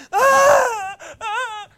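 high, loud mock wail of a hurt child; child's wail. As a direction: wail like an overreacting kid who bumped a hip. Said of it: "They hit their hip".

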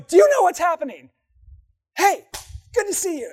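very high, loud, gushing greeting; super excited. Acted. Gabriel bursting in on Mary, super excited. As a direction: burst in bubbling over with news. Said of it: "He's probably super excited".

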